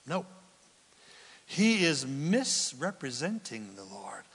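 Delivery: ordinary talk, nothing marked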